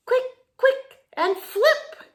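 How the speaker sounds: high, loud urgent call; urgent cry. A narrator's reading. Wise beetle crying out to save the young one. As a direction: shout the warning fast. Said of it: "cried the wise old click beetle".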